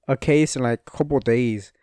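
ordinary talk, nothing marked

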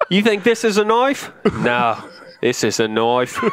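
Crocodile Dundee Aussie voice; Australian accent. Retold or imitated. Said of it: "Just talk like that?".

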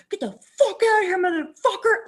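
high, loud mock screech; reenacted yelling. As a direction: yell it wildly, as if chasing off a hawk. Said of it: "So I'm yelling".

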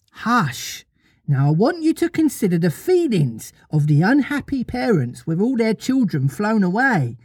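high, lilting little-mother storyteller; childlike storytelling voice; Wendy telling a story. Acted. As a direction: pitch it up, telling children a tale.

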